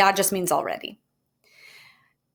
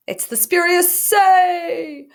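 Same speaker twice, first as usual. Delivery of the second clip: much higher and louder, slow and drawn out; grand announcement; 11 semitones higher, 5 dB louder, slower than usual. Real, not acted. Teacher unveiling her favorite grammar term, grand and drawn out. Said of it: "my favorite name for any grammatical term".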